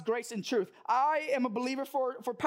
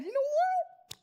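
excited decision. Retold or imitated. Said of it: "So I said".

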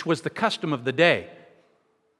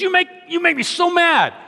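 mock angry stranger; 11 semitones higher than usual. Preacher retelling the stranger's outburst, hammed-up anger. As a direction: whine it like an offended stranger.

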